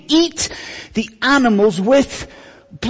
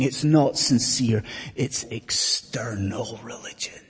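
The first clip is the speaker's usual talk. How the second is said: an unvoiced, much softer whisper; a quiet, whispered verdict; 10 semitones lower, 10 dB quieter than usual. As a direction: drop to a whisper to condemn. Preacher's hushed judgment.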